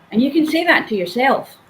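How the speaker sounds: usual speaking voice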